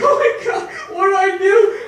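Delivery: high, loud mock panic; panicked high-pitched yell, played up; freaking out. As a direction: shout it in a comic panic. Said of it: "I'm freaking out".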